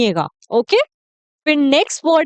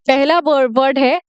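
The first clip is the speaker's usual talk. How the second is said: normal talking voice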